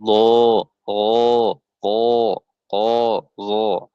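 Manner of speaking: "Lo, ho, ko, ko, zo" long and hollow; thick, heavy letters. Shown as a demonstration. Teacher demonstrates thick sound, syllables long and heavy. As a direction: make each syllable full at the back of the mouth. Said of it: "suaranya tebal".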